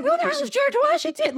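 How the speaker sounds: high, fast, hammed-up disbelief; baffled outburst. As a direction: squeal the question in mock bewilderment. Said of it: "he's very confused".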